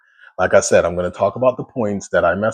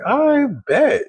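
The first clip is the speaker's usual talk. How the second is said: eager agreement. Retold or imitated. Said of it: "And I'm like".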